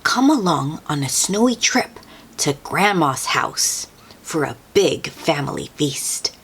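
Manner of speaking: usual speaking voice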